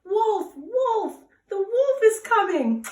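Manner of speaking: high, loud cry of alarm; shouting. Acted. Shepherd boy fakes an alarm to the village, loud high shout. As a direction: yell it out toward the village, pitched high. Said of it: "started shouting in the direction".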